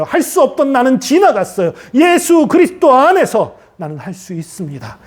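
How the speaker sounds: high, building sermon crescendo; impassioned declaration. Real, not acted. Preacher leading believers to leave failure behind, impassioned declaration.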